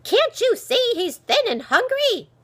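high, loud indignant shout; sharp, outraged old woman; angry scolding. A narrator's reading. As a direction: scold them for their cruelty. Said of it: "Angrily, she pushed her way".

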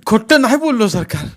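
high, loud cry of protest; anguished pleading. A narrator's reading. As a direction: plead in pain with the officer. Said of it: "कोलमडत कळवळून बोलला".

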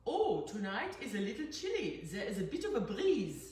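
put-on thick German accent. German stepfather remarking on the evening breeze, thick German accent. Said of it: "said in a very thick accent".

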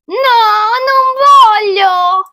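high, loud, drawn-out protest; child's refusal. Acted. Child refusing to become a pumpkin, high loud wail.

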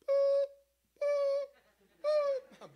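high reversing beeps; squeaky beep-beep of a vehicle in reverse; beeping truck noise. Pretend. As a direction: mimic a truck backing up. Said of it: "there are always moments when we feel like".

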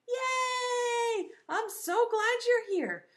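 high-pitched gleeful welcome; excited cheer. Real, not acted. Teacher welcoming children to class, excited cheer. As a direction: cheer them in brightly, drawn-out yay.

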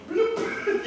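high, drawn-out bloop with a laugh; comic sinking noise. Retold or imitated. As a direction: imitate dropping underwater, laughing. Imitating the sound of sinking.

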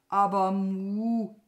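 loud, long drawn-out moo; mooing protest. Acted. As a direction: stretch the word into a cow's moo. (gedehnt protestierend muhen)

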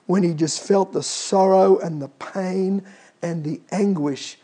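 ordinary talk, nothing marked

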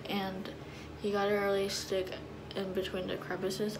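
fingers pressing on teeth; talking while applying strips; whitening strips on teeth. Real, not acted. Seen 2: Fingers press strips onto teeth; thin strips faintly visible on teeth.

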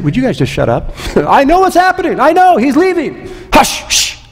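exasperated prophet, high and testy, ending in a hush; irritated Elisha. Acted. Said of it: "Elisha is a little bit irritated".

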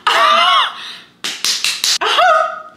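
piercing shriek of disbelief, breaking into a laugh; high outraged shriek. Real, not acted. Venting to viewers about a rude girl, high outraged shriek. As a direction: let out a high scream of outrage.

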